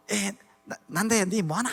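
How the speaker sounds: a light Daegu dialect phone greeting. Retold or imitated. An older Korean student phoning him, light Daegu dialect. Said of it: "대구 사투리".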